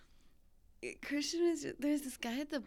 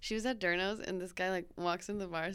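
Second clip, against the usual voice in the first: ordinary talk, nothing marked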